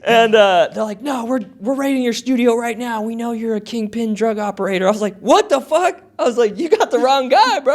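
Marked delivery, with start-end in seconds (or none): 1.0-4.9 higher-pitched retold police voice; accusing cop. 5.2-6.0 high-pitched incredulous outburst; shocked disbelief. Retold or imitated. Shocked at the accusation, retold. 6.6-7.7 high-pitched retold protest; pleading innocence.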